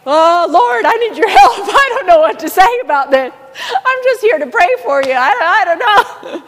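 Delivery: high, loud flustered plea to the Lord, laughing through it; flustered prayer. Retold or imitated. Young prayer-line volunteer stumped by the caller, flustered and pleading. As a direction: stammer for help, half laughing. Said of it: "Brother Keith's like".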